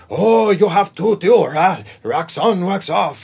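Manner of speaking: high, slow mock Japanese accent; Mister Miyagi accent. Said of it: "Mister Miyagi".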